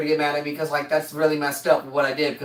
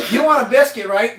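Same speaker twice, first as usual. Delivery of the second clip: louder clueless server; 4 semitones higher, 6 dB louder than usual. Retold or imitated. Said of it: "the person's like".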